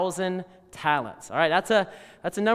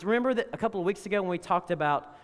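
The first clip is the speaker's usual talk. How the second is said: ordinary talk, nothing marked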